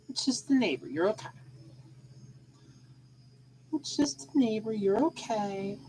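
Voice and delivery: calm the pet down gently; hushed reassurance to a scared cat; reassuring the cat. Real, not acted.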